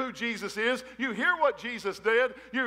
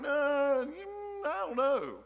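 high-pitched, hesitant mock refusal; acted reluctance; 5 semitones higher, much slower than usual. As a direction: balk at the dare in a high, wavering-on-the-decision voice. Acts out refusing to jump.

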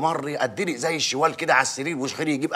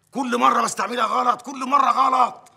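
indignant; 6 semitones higher, 4 dB louder than usual. Acted. Mayor offended by the accusation.

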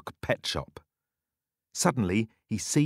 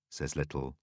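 plain reading voice

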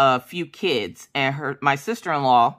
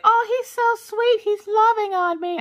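put-on very high, slow, gushing girly voice; imitating her friend melting over the puppy. Friend cuddling the puppy against her chest, high gushing. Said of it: "and she's like".

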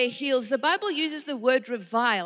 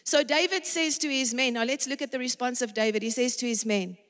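ordinary delivery

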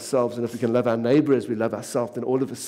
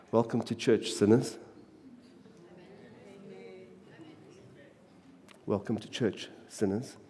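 quiet welcome; 2 semitones higher, 8 dB quieter than usual. Acted. Softly repeated greeting.

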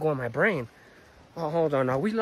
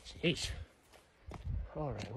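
normal talking voice

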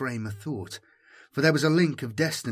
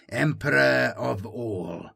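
Inquisitor's slow proclamation, "Emperor" drawn out; proclaimed watchword; slower than usual. Acted. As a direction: intone "Emperor of all" slowly as a command to the ranks. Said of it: "chorused in response".

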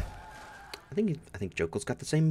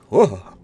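loud startled oh; 3 semitones higher, 15 dB louder than usual. Pretend. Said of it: "I don't know what that sound is".

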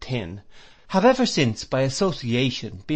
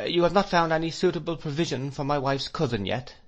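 put-on higher-pitched old schoolmaster's query; lightly voiced doctor, pitched up; Doctor Strong; 6 semitones higher than usual. A narrator's reading. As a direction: ask it in a raised, questioning voice.

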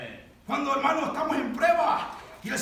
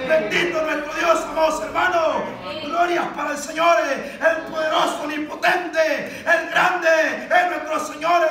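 loud shouted praise from a preacher; full-force preaching; 3 semitones higher, 7 dB louder than usual. Real, not acted. Preacher gives glory to the Lord, loud fervent praise. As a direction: proclaim each title of God forcefully.

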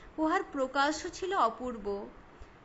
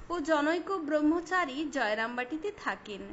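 ordinary delivery

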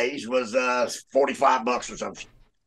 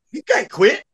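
higher-pitched mock disbelief; incredulous guard; 10 semitones higher, 4 dB louder, slower than usual. Guard answering an inmate who quit, incredulous. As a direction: retell it as a baffled guard.

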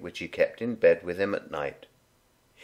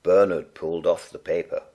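ordinary delivery

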